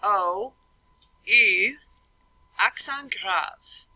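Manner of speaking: "O, U, accent grave" in French, spaced out; French spelling aloud. Shown as a demonstration. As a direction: spell it slowly in French for a class. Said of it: "The next one I'd have".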